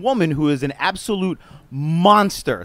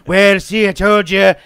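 loud smug gloat; mock gloating Trump; 3 semitones higher, 8 dB louder, slower than usual. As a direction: gloat it loudly. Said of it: "go out there and be like".

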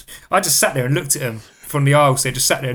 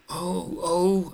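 soft, higher 'oh, oh'; small mock moan, pitched up; imitated pained reaction; 4 semitones higher, 7 dB quieter than usual. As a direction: quietly mimic someone in sudden discomfort. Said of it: "she pulled her face".

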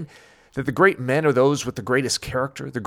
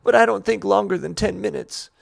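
put-on child voice; 6 semitones higher, 4 dB louder than usual.

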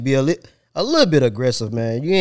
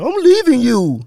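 higher, louder, put-on woman's voice storming off; mock girlfriend leaving; 7 dB louder than usual. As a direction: play the fed-up girlfriend walking out, high and loud. Voicing an angry girlfriend leaving.

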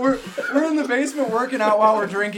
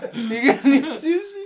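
mock drunk; 6 semitones higher, 4 dB louder than usual. Mock drunk asking for sushi.

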